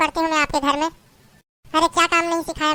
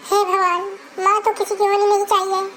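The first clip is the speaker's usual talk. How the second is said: wailing, tearful longing for her mother; crying lament; 5 semitones higher than usual. Acted. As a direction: sob it out, calling on God for her dead mother. Bride weeps for dead mother.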